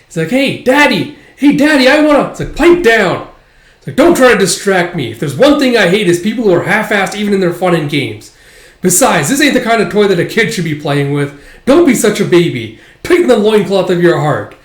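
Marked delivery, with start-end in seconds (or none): 0.3-2.3 put-on very high, loud kid voice; insistent, excited child's call; pestering child. Child pesters father for a turn. 2.5-3.3 loud, higher-pitched angry telling-off; irate dad, voice raised hard; scolding father. Acted. Father silences child. 4.0-14.5 loud, raised-pitch irritated rebuke; gruffly annoyed parental lecture; lecturing father. Acted. Father rebukes child in acted story.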